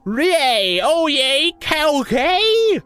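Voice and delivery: high, slow, put-on goofy voice; Mike's inquiry. A narrator's reading. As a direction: stretch it out high like a cartoon oaf. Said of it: "he inquired".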